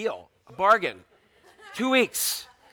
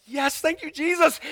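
much higher, hammed-up praise; mock thankful; 8 semitones higher, slower than usual. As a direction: thank heaven in playful relief. Parents relieved at breakup.

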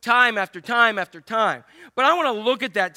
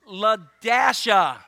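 drawn out; much slower than usual. Pretend. Finally reading out strange name.

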